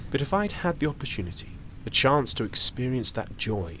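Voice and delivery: usual speaking voice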